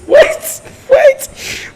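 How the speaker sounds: laughing disbelief. Real, not acted. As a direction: crack up mid-word in shock. Reading absurd line, cracks up.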